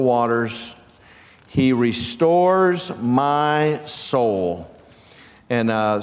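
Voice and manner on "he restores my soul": slow, reverent reading. Acted. Slow drawn-out scripture line.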